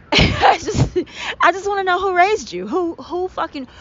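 high-pitched, breaking into laughter mid-rant; real laughter cracking the indignation; stifled laughter. Real, not acted. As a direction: try to get the words out through laughs. Breaking up while ranting at coworker.

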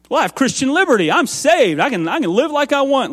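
mock self-righteous protest; defiant retort. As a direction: push back like an indignant believer. Arguing back to the Lord.

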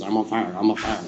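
low, subdued [name] take. Retold or imitated. Co-host with only one line as the statue, low mock [name]. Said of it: "[name] trying to do, like, an [name] impression".

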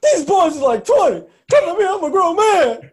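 very high, loud hammed-up yell; mock screaming coach. Coach screaming at reporters to defend his players, very high and loud. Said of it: "He's like".

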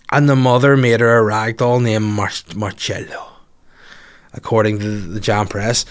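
ordinary delivery

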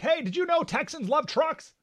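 high, wide-eyed announcing; mock naive report. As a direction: play a clueless reporter sharing big news. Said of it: "it's like they're reporting back".

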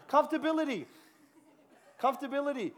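amused by the word; laughing repeat. Real, not acted. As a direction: say it through a laugh.

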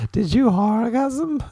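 high, drawn-out creepy question; silly put-on voice. Dude asking a girl on a date, high silly voice. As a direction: ask it slow and high, with a laugh behind it. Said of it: "Gonna use that voice from now on".

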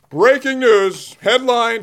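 slow, drawn-out newscaster opening; hammed-up anchor voice; mock breaking news. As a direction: announce it like big TV news.

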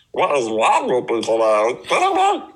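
garbled cartoon monster, swooping high; Rick from Big Mouth. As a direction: slur and yelp like a cartoon oddball. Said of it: "Rick from Big Mouth".

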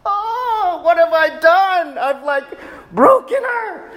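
very high, wailing dismay; heartbroken. Retold or imitated. Father horrified at making daughter cry, high anguished mock wail. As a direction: gasp it out as if you've broken something precious. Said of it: "I'm like".

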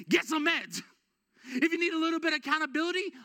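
loud, high, driving sermon voice; preaching at full force. Acted. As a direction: preach it hard and push the congregation.

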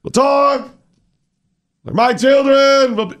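high, hammed-up church preacher cadence; mock preacher voice. Mocking a politician pandering in church, high preacher voice. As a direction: lampoon a pandering pulpit voice.

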